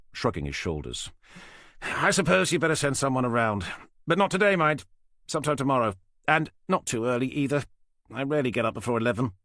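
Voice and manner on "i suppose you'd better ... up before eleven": lightly voiced smug older man; dismissive, a little higher and louder. Falcon's held voice.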